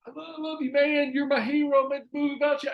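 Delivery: high, strained voice of a dying friend; hammed-up emotional goodbye; mock tearful farewell. Dying friend telling Randall he loves him, high and mock tearful.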